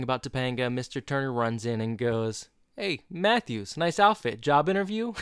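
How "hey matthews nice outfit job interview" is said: higher-pitched, jokey teacher greeting; teasing teacher. Retold or imitated. Teacher runs in and ribs his student, teasing. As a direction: toss out a teasing wisecrack as the teacher.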